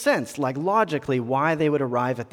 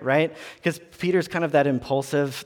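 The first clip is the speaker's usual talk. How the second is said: usual speaking voice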